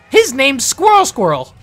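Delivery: high, loud gnome introduction; proud gnome. Acted. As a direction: announce it proudly as a little gnome. Gnome introduces his squirrel.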